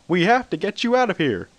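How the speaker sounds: high, loud pitchman hype; Billy Mays sales voice. A narrator's reading. Billy Mays urges Spider-Man to escape, pitchman-loud. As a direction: sell it like a TV ad pitchman. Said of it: "in his trademark sales voice".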